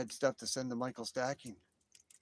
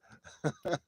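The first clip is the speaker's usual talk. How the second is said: short high laugh; amused laugh; 6 semitones higher than usual. Real, not acted. Laughing with viewers after whistling, amused laugh. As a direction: let another laugh burst out.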